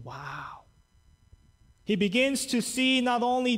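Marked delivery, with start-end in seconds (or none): 0.0-0.6 awed hushed wow. Retold or imitated. Nathanael awed by the healing.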